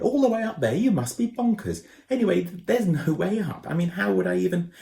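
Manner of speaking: put-on high, flustered boy voice; boy Ben, flustered. A narrator's reading. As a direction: squirm out of the job, panicky. Ben's voice in dialogue.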